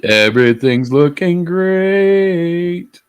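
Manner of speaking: high, loud, slow sing-song. Acted. Playful reply while setting up.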